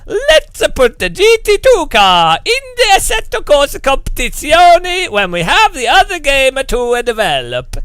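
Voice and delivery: loud, high, hammed-up Italian accent; gleeful cartoon Italian boss, sing-song; Marco, Italian accent. Designer orders a car into the game, exuberant mock Italian. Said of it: "And Marco's like".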